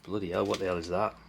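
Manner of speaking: ordinary delivery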